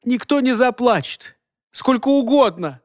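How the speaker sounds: high, tearful defiance; sniffling defiance. A narrator's reading. Crying girl driving Holger away, sniffling. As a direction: insist on not caring, voice lifted with tears. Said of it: "шмыгая носом, гнала его".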